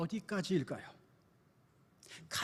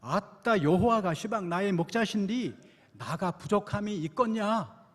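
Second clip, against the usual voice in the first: Jeolla dialect; 2 semitones higher, 4 dB louder than usual. Retold or imitated. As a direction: play it folksy in Jeolla speech. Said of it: "전라도 버전입니다".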